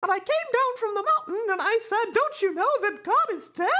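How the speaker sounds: very high preaching mouse parody; Mickey. Acted. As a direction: proclaim it like a squeaky cartoon prophet. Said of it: "Mickey replies".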